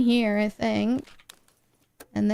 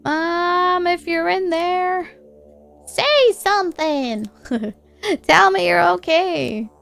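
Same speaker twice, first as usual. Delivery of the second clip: higher, louder calling for Mom; 6 semitones higher, 4 dB louder, slower than usual. Acted. Player shouting through the bookshelf, worried. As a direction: call out anxiously to her.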